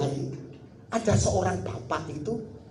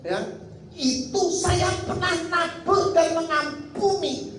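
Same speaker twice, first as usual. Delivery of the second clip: emphatic preaching; 8 semitones higher than usual. Real, not acted. Preacher proclaims the lesson. (khotbah lantang bernada tinggi, lambat)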